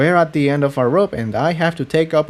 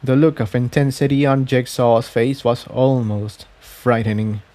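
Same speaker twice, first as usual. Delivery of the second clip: ordinary delivery, nothing marked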